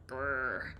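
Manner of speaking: low growl through clenched jaws; angry growl. Retold or imitated. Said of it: "Our jaws were like".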